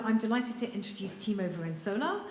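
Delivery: ordinary talk, nothing marked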